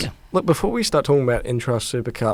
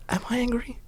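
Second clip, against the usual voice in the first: whisper; 10 semitones higher, 11 dB quieter than usual. Pretend. Self-questioning aside after mock anger.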